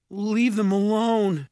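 high, strained plea; shaky. A narrator's reading. Jared begging the ogre to spare them, shaky. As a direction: plead shakily, pitched well up. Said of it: "Jared said shakily".

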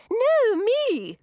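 very high, loud squeal; high, loud, insistent fairy cry; competing cry. A narrator's reading. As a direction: outdo the last fairy, desperate for a go. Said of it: "cried another".

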